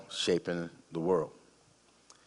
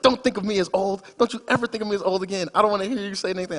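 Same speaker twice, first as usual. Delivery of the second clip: high, loud, fast mock outburst; indignant ex-wife; 12 semitones higher, 5 dB louder, much faster than usual. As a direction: rattle it off like an offended ex-wife snapping back. Ex-wife snapping at husband.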